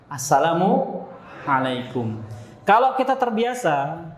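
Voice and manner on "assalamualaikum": "Assalamualaikum" very slow, split before a throaty ain on "alaikum"; greeting with ain. Shown as a demonstration. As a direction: pause inside "Assalamualaikum", then voice the ain from the throat. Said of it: "salamnya pakai ain". ("Assalamualaikum" sangat pelan, ain ditekankan)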